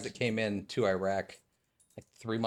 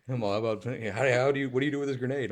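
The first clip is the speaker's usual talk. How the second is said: higher, eager silly question voice; mock clueless officer; 5 semitones higher, 5 dB louder than usual. Veteran mocking a new officer's question, higher and eager. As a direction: ham up a clueless officer asking.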